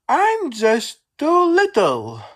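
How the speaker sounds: small engine's high, tearful cry; Percy crying. A narrator's reading. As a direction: wail it like a tiny upset engine. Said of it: "cried Percy".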